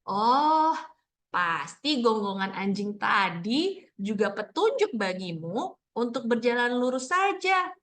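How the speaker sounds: animated, slow picture-book reading; reading to children. Shown as a demonstration. Teacher modelling a picture book read-aloud, slow and expressive.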